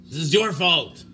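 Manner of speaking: imitated demanding crowd. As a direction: imitate people turning on you and blaming. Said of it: "people are like".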